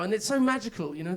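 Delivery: ordinary delivery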